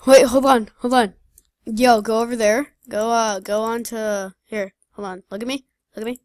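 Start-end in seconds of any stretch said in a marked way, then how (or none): 0.1-4.7 loud call-out. Real, not acted. Calling out in game.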